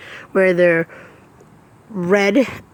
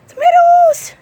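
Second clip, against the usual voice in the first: very high, loud excited squeal; 7 dB louder, slower than usual. Real, not acted. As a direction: shriek it with delight. Excited garden tour exclamation.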